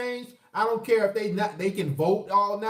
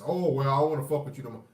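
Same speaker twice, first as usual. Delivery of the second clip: mimicking flaky woman; 7 semitones lower, faster than usual. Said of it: "they just".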